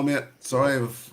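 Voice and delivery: ordinary delivery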